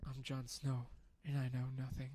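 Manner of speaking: lightly voiced Jon Snow quote; a hint of brooding Jon Snow; Jon Snow impression. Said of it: "I was just about to make".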